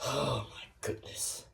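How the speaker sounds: very quiet, low exclamation; low, hushed dismay; stunned muttering. Acted. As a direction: breathe it softly to yourself. Left alone after customer storms out.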